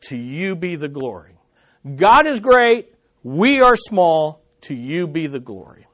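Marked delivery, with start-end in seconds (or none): 0.1-1.4 soft reverent response, voice dropped; whisper-soft praise to God; hushed prayer. Real, not acted. Quiet doxology after each statement. 4.6-5.8 soft reverent response, voice dropped; whisper-soft praise to God; hushed prayer. Real, not acted. Quiet doxology after each statement.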